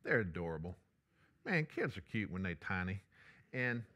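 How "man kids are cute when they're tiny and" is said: ordinary talk, nothing marked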